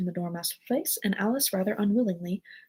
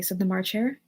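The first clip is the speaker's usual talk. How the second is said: usual speaking voice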